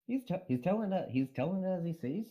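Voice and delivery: put-on high, tentative Scottish accent; shaky try at Scottish; bad Scottish accent. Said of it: "answer in a Scottish accent".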